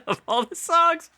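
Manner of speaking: laughing high through the words; words squeezed out mid-laugh. Real, not acted. Host laughing while describing the montage, laughing.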